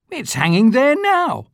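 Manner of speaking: put-on a young boy stating the obvious; boy's voice. A narrator's reading. Street boy answering Scrooge, high boyish voice.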